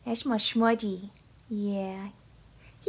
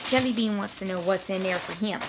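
ordinary talk

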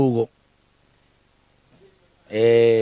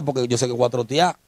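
ordinary delivery

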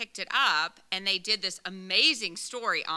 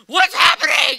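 loud, startled question; alarmed dad; 10 semitones higher, 14 dB louder than usual. Retold or imitated. Dad driving the truck, startled by the chaos, loud alarmed question. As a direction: shout it confused and alarmed.

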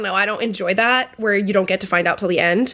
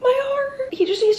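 swooning; 14 semitones higher than usual. Real, not acted. Moved by a romantic scene.

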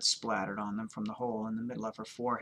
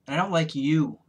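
raised, louder hostile character line; openly hostile, higher and louder; 5 semitones higher, 9 dB louder than usual. A narrator's reading. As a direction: push the dislike out loudly. Hostile woman confronts the narrator.

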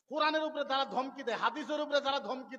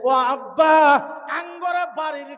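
slow wailing lament; 14 dB louder, much slower than usual. Acted. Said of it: "কানতে কানতে আসছে".

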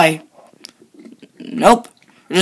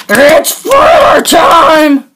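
slow, booming, higher villain cry; villain shout; 5 semitones higher, 4 dB louder, much slower than usual. Acted. Villain bursts in on the heroes with a slow booming cry. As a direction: roll it out like a theatrical villain. Villain announces new attack.

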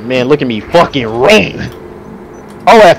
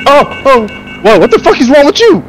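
startled shout; 12 semitones higher than usual. Real, not acted. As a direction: yell out in sudden shock. Attacked by monster suddenly.

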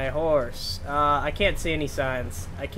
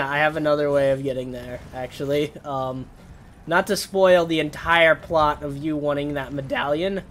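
ordinary talk

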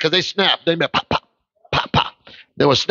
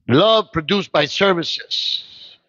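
even slower, drawn out; slower emphatic; much slower than usual. Real, not acted. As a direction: stretch every word.

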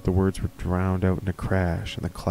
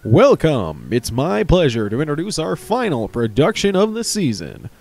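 theatre host; 7 semitones higher, 4 dB louder than usual. Acted. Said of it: "A man walked out".

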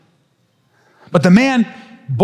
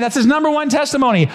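ordinary talk, nothing marked

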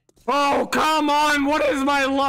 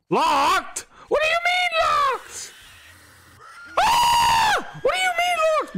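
high indignant screaming; outraged shriek. Real, not acted. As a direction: shriek in disbelief. Outraged at locked door.

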